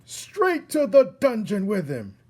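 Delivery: high, loud jeering cry; angry mob yell. Acted. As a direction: demand he be locked away. Mob demanding the dungeon.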